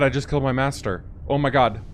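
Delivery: ordinary talk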